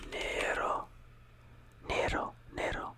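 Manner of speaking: nero with a rolled R, NEH-ro; Italian nero. Shown as a demonstration. Teacher modelling nero with a rolled R. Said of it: "You roll your R's".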